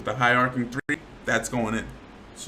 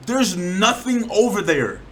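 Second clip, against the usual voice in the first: louder, higher, drawn-out exasperation; pissed off; 6 semitones higher, 6 dB louder, slower than usual. Real, not acted. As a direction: stretch it out in real frustration. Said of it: "That's why I'm getting pissed".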